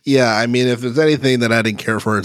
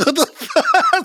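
laughing disbelief; slower than usual. Retold or imitated. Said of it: "And I'm like".

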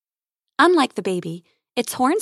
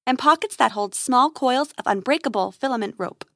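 usual speaking voice

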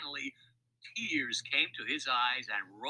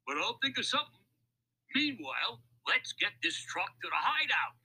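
high-pitched crook taking charge; scheming crook; 8 semitones higher than usual. A narrator's reading. Louis sends Lefty to the hideout, scheming crook. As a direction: give orders in a high crook's voice. Crook gives his partner orders.

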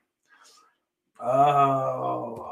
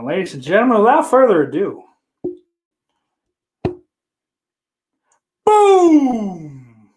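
loud, raised build-up to a long boom; showman's announcement; 6 semitones higher, 8 dB louder than usual. Pretend. Streamer unveils picture of the week, loud showman announcer. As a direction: announce the big reveal, booming the last word.

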